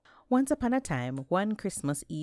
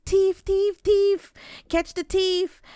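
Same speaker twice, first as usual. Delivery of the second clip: 1 crowd yelling thief; 8 dB louder, slower than usual. A narrator's reading. Crowd chasing Anansi through market.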